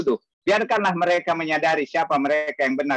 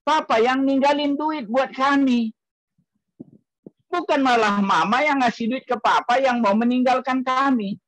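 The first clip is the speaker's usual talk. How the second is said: objecting daughter; 9 semitones higher than usual. Retold or imitated. Daughter telling Dad he should leave them money, indignant. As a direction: imitate a daughter arguing back. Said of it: "Putri saya jadi lawan saya".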